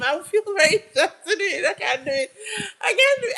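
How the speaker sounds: high, helpless laughter through the words; embarrassed laughing. Real, not acted. Streamer refusing to show viewers the accent, laughing helplessly. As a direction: giggle as you back out.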